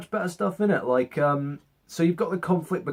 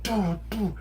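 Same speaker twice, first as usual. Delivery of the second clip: higher, mouthed clang of blades; lightsaber clash noises; 6 semitones higher than usual. Retold or imitated. Luke striking Vader in the duel, voiced blade clashes. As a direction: tap out the hits with your voice.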